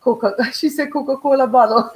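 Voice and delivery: laughing through the words. Real, not acted. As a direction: giggle as you say it. Laughing while telling.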